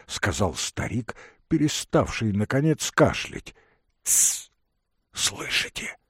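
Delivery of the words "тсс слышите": urgent hushed whisper. A narrator's reading. Young man hushing the old man under the bed, whispering. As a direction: hiss a quick warning to listen. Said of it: "прошептал молодой человек".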